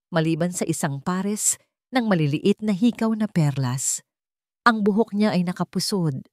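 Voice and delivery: plain reading voice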